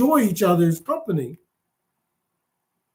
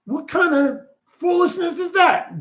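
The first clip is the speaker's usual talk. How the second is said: higher-pitched, incredulous commander; acted exasperation; 7 semitones higher than usual. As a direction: play a commander baffled by a useless report. Enemy commander exasperated at report.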